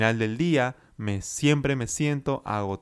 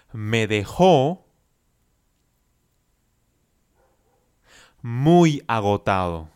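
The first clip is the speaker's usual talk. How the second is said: chunked model phrase; 3 semitones higher than usual. Shown as a demonstration. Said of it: "Repita después de mí".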